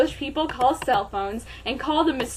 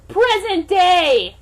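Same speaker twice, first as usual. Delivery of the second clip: slow, loud, higher proclamation; dramatic announcement; 7 semitones higher, 9 dB louder, much slower than usual. Acted. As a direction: boom it out. Dramatic time jump.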